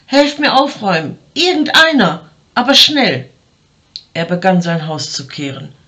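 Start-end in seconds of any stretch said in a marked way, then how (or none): 0.1-3.3 higher, louder urgent plea; calling out. A narrator's reading. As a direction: shout for help, hurried. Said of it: "rief er". (dringender, lauter Hilferuf)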